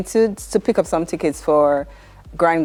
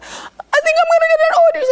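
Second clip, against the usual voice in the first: much higher, fast excited squeal; 4 dB louder, faster than usual. Retold or imitated. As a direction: squeal it out in disbelief, high and quick. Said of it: "I said".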